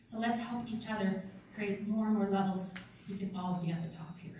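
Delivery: normal talking voice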